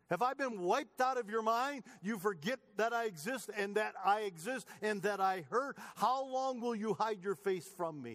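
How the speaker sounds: preacher voicing the psalmist's anguish; pleading psalm reading. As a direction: cry out the psalm like your own complaint. Impassioned reading of psalm lament.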